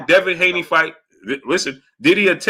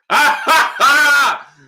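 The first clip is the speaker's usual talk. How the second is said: high, ringing "ha ha" laugh; gloating laugh; 11 semitones higher, 5 dB louder, much slower than usual. Real, not acted. Host laughing at a caller who hung up, gloating laugh. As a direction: crow with high, drawn-out laughter.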